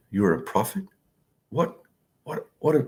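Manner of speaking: hushed, stunned "What?" repeated in disbelief; a quiet, stunned double take, not a shout. Retold or imitated. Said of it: "Bilal radiallahu anhu was shocked".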